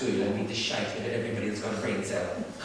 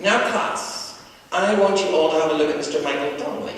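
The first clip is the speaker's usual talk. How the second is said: proud teacher; 4 semitones higher, 7 dB louder than usual. Acted. Teacher addressing class proudly.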